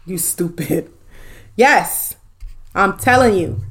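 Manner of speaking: usual speaking voice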